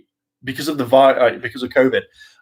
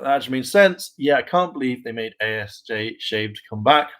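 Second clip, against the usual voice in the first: ordinary delivery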